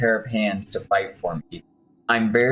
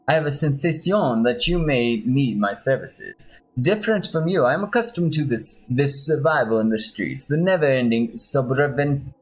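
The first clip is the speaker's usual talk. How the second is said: put-on a light Spanish accent, a bit higher; mild Spanish accent, stumbling on sobrevivencia; gentle put-on Spanish accent; 4 semitones higher than usual. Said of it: "my Spanish accent".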